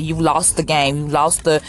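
ordinary talk, nothing marked

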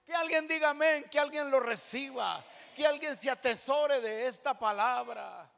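loud, high urging to the crowd; rally them to answer; fervent altar call. Real, not acted. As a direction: pastor urging the congregation to shout back. Calling congregation to respond.

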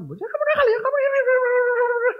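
very high, loud repeating ya ya ya; nagging mother. Retold or imitated. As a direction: rattle off a mother's scolding. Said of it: "Tapi kalau mama". (ibu mengomel ya ya ya, suara sangat tinggi)

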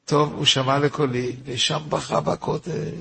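ordinary talk

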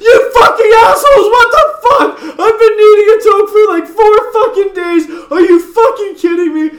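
high, loud outraged yell through laughter; mock furious rant. Roommate cheated out of weed, yelling high and loud through laughter. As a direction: shriek it like you've been betrayed. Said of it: "And he's like".

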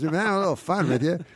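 high, quick prank reveal; imitated jolly host. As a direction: rattle it off as the pleased host.

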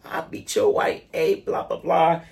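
lightly voiced angry mom; mock angry mother. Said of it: "the lady is like".

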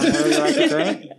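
loud crackly drive thru order box; drive thru speaker. Acted. He's a drive-through worker.

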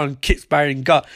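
ordinary talk, nothing marked

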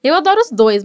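ordinary talk

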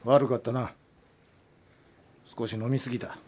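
low, soft, deflated; low and soft, spent; subdued apology. Acted. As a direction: mutter it ashamed. Director apologizes after fight.

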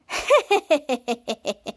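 long staccato villain giggle; imitated toddler laugh. The toddler laughing in the man cave, staccato acted cackle. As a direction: cackle in even, repeated bursts. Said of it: "The toddler laughing".

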